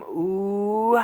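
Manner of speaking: high, sleazy put-on coo; sleazy ooh. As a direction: croon it like a lounge lizard. Sleazy bedroom boast bit.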